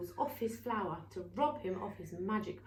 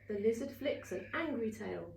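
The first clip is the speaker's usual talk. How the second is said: plain reading voice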